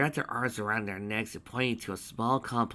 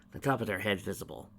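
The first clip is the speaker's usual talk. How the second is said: ordinary delivery, nothing marked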